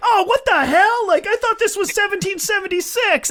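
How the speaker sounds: high whiny indignant squeal; mock outrage. Republicans slammed for the riot, high indignant whine. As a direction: squeal it high like a betrayed patriot. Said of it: "they're like".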